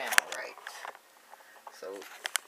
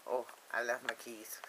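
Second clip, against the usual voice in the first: normal talking voice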